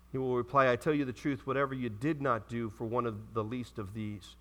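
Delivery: low, soft reading voice; solemn Scripture reading. Acted. Preacher reads the judgment passage to the congregation, solemn and low. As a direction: read it out low and subdued.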